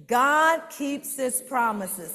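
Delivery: God keeps his promises slow, high and loud; with feeling. Shown as a demonstration. Teacher modeling the response with feeling, loud and slow. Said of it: "But you gotta say it with feeling".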